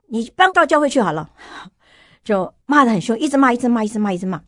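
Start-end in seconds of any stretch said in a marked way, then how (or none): 0.1-1.3 retold angry yell. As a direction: imitate the husband snapping at his wife. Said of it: "有一天他就大骂我". (模仿丈夫生气地大骂)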